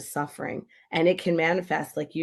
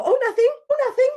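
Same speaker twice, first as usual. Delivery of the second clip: high, louder falsetto brushing it off; mock brush-off; 7 dB louder, faster than usual. Mom hiding tears from her kid, high fake brightness. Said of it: "And they go".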